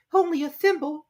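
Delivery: put-on small, high, sad girl's voice; a little sorry girl. Alice sadly admitting to the dodo. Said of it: "said Alice sadly".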